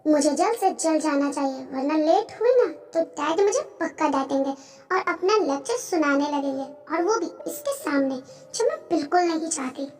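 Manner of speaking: soft, private inner voice; hushed anxious aside; worried inner thought. Acted. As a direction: fret quietly to yourself. Character worries silently about father.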